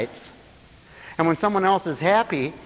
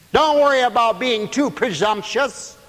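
full-voiced preaching; 9 semitones higher, 3 dB louder than usual. Real, not acted. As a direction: preach it out, stretching the phrases high. Preaches exhortation.

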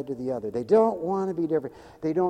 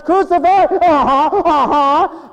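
mock agreeing crowd; 11 semitones higher, 8 dB louder, slower than usual.

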